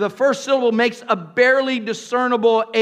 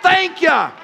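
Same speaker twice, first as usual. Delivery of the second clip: shout; 5 semitones higher than usual. Shown as a demonstration. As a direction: call it out to the congregation. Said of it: "Somebody shout".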